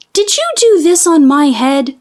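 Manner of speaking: high, earnest little mole; little mole asking. A narrator's reading. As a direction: ask it like a small puzzled mole. Mole asks the hare.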